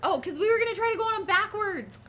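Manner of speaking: high, loud excited blurt; excited remembering. Real, not acted. Friend recalling their airport plan, loud and excited. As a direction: burst out with the answer.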